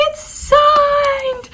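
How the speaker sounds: high, loud squealing shout of joy; excited squeal. Retold or imitated. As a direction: squeal it like you just found a treasure. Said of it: "I squealed".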